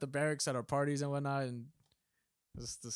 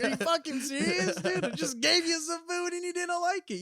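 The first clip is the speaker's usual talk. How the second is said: high, raised mock disbelief; retold incredulous outrage; 13 semitones higher, 4 dB louder than usual. Storyteller to homeless man who threw food back, mock incredulous. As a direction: protest in pitched-up disbelief.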